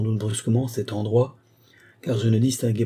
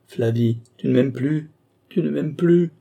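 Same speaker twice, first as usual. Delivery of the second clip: rising, higher pleading, louder by the end; tearful plea; 5 semitones higher, 3 dB louder than usual. A narrator's reading. Sobbing boy pleading with Flavie, rising higher and louder. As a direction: beg her through tears.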